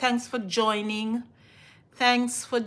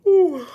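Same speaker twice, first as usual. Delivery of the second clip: excited ooh; 8 semitones higher, 12 dB louder than usual. Real, not acted. Excited reaction sound.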